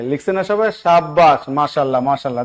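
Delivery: ordinary talk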